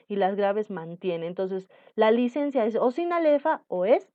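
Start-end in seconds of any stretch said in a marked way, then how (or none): none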